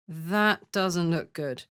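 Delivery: ordinary talk, nothing marked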